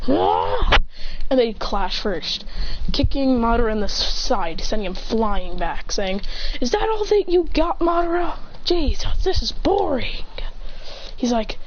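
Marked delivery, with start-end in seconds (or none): 0.1-0.8 high-pitched charging cry; acted anime fight yell; battle yell. Charge cry before clash. 6.6-10.3 high-pitched cocky goading; acted unimpressed taunt; mocking taunt. Taunts opponent mid-fight.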